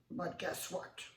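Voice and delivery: plain reading voice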